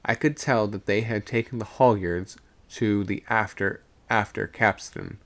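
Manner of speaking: plain reading voice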